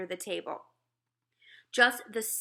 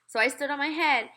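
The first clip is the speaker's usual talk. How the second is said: plain reading voice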